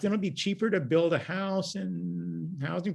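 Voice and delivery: sarcastic mock worry. Sarcastic mock alarm about cheap timber.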